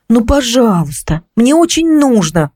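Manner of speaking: slow, drawn-out begging; plead, stretching the words. A narrator's reading. Visitor begs neighbour to let her look, pleading. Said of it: "взмолилась я".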